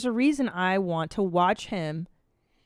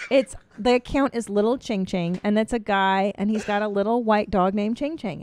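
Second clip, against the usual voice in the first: usual speaking voice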